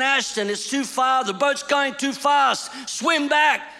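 shouting to him to swim back; urgent yell across the water; father calling his son back from the shore. Retold or imitated. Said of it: "And I kept saying".

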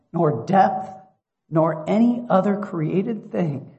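loud, high, ringing proclamation; impassioned scripture reading. Real, not acted. Preacher convincing listeners nothing can separate them, impassioned scripture reading. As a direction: build the list phrase by phrase, slow and emphatic.

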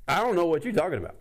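high, loud, fast brush-off; defensive denial. Retold or imitated. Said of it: "and he's like".

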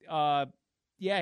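ordinary delivery

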